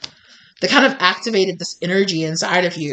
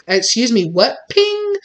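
sassy goddess; 4 semitones higher, slower than usual. Retold or imitated. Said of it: "like, goddess energy".

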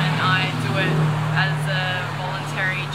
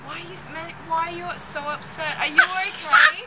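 concerned friend; 8 semitones higher than usual. Retold or imitated. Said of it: "people would be like".

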